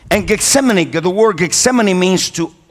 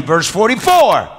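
normal talking voice

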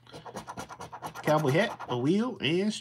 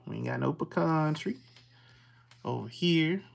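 ordinary talk

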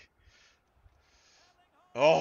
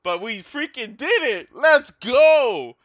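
usual speaking voice

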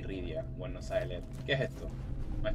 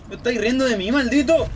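higher, louder outraged challenge; mock indignant yell; 7 semitones higher, 4 dB louder than usual. Real, not acted. Gamer to the mocking boss, hammed-up indignant yell.

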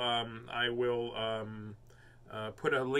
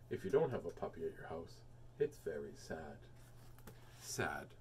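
mock sad; 9 semitones lower, 11 dB quieter than usual. Mock sad after puppy joke.

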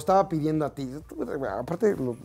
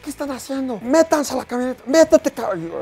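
much higher, louder commanding shouts; yelling orders; 9 semitones higher, 4 dB louder than usual. Retold or imitated. Officer yelling at soldiers to get in the van, shouting. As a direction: order them into the van harshly. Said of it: "les empieza a gritar".

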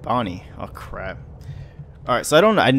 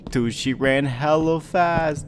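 ordinary talk, nothing marked

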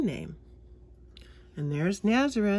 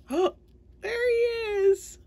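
delighted surprise; 4 dB louder, slower than usual. Real, not acted. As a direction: gasp then high, slow delight. Gasps on finding the mouse.

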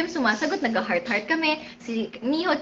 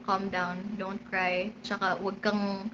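calming reassurance; 6 semitones lower, 7 dB quieter than usual. Retold or imitated. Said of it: "Sabi ko".

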